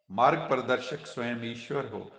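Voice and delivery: ordinary delivery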